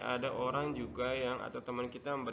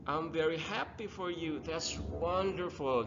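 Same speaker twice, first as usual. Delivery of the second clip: expressive congratulations; 5 semitones higher than usual. Shown as a demonstration. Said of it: "ekspresinya ya, harus keluar".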